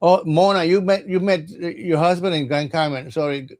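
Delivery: ordinary talk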